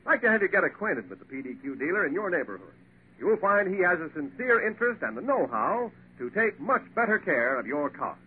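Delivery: ordinary talk, nothing marked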